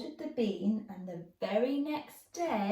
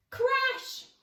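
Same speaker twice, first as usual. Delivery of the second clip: crash sound. A narrator's reading. Falling with a crash.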